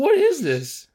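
high, squeaky mock disbelief; incredulous. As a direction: pitch it up like you can't believe it. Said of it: "I was like".

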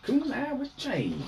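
high, slow, drawn-out announcer; dramatic anime voice. Pretend. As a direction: call the card name like a duelist. Echoing card name in voice.

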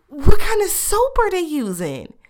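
high and loud, disbelieving; incredulous mockery. Real, not acted. Podcaster mocking an overpriced car wash, incredulous. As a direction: ask it like it's absurd.

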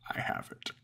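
whispered, creaturely line; whispered monster. A narrator's reading. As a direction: hush it like a secret hoard. Monster pokes its belly.

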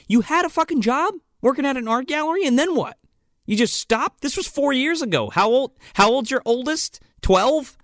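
high, mocking disbelief; incredulous. Podcaster mocking the heroine's choices, high incredulous scoff. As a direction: scoff at her quitting the job. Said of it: "I'm thinking to myself".

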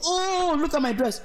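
a startled squeal over a soaked dress; playful imitation of someone splashed by a car; mock dismayed cry. Quoting the splashed pedestrian's cry.

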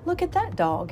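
high, eager exclamation; greedy surprise. Acted. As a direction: spot a bigger bone. Dog sees reflection, greedy.

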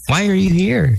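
usual speaking voice

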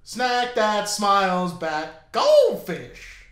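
very slow, drawn-out jingle voice; goldfish cracker voice. Retold or imitated. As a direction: stretch the slogan way out like the ad. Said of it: "Okay, ready?".

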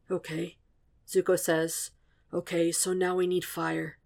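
barely audible, close to his ear; very soft voice. A narrator's reading. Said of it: "His voice is so soft".

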